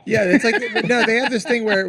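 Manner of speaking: loud, high laughing. Real, not acted. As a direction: laugh through it. Laughing reply.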